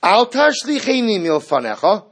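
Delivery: a bold declaration to God; declarative statement. Shown as a demonstration. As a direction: state it as a demand, not a plea. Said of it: "That is a declarative statement".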